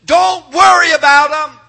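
loud, high pulpit cry; big confident shout from on high; shouted divine assurance. Acted. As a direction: proclaim it as God, full voice. God's reassurance shouted by preacher.

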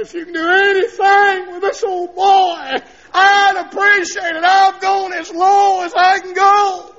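high, loud, tearful plea; broken man crying out to God in the bedroom; crying prayer. Retold or imitated. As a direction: beg God through tears. His own desperate prayer.

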